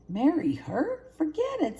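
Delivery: high squeal of disgust, marry her; outraged young cub. A narrator's reading. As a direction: recoil at the very idea. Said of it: "said Simba".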